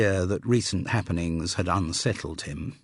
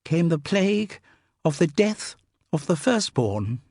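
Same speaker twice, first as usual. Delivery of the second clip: awestruck; 10 semitones higher, 3 dB louder than usual. Acted. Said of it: "in awestruck tones".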